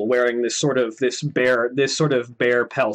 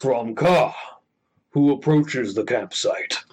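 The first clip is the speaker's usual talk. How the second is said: ultra low rumble; slower than usual. Acted. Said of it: "a deep rumbling voice sort of call out".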